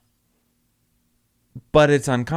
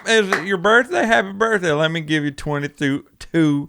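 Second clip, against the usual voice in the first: put-on country accent; 5 semitones higher than usual. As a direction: ham up a flirty country accent. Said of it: "He has a country accent".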